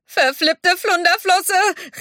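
high, drawn-out exclamation; slow, high-pitched comic cry; cried-out curse. A narrator's reading. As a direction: wail a mild curse while tumbling away. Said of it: "rief er, als er durchs Wasser wirbelte".

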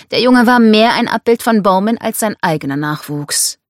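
ordinary delivery, nothing marked

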